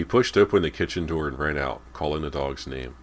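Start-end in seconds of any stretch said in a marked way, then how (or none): none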